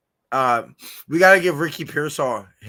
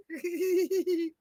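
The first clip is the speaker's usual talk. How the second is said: hysterical giggle; 4 dB quieter than usual. Shown as a demonstration. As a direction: demo of a high hysterical giggle. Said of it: "Like that".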